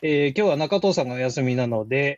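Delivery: measured radio introduction, slowing to the close; show opening read. Real, not acted. Guest standing in for the absent host, measured opening announcement. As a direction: present the programme in tidy phrases.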